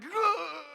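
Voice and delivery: sudden high, loud startled yelp; terrified scream. Acted. Shepherd seeing the angel appear, a loud startled yelp. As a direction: scream as if jolted out of your skin. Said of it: "you're terrified".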